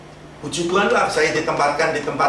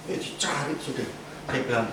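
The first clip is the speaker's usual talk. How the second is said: ordinary talk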